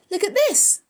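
high, loud call for attention; urgent, high-pitched exclamation; excited cry. A narrator's reading. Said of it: "cried Avery".

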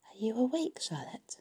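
hushed, tentative question; soft. A narrator's reading. Wilbur checks whether Charlotte is awake, softly. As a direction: ask gently, fading almost to a whisper. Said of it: "he said softly".

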